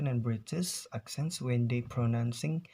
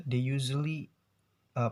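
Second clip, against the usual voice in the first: ordinary talk, nothing marked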